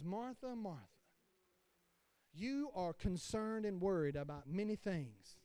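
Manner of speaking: soft, calm correction; softly voiced Jesus calming Martha; gentle Jesus. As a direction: ease her down gently.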